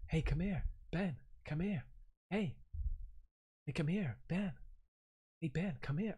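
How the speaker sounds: soft, hushed imitation of the ghostly American call; quiet spooky American calling a name; imitating American voice. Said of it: "my American voice".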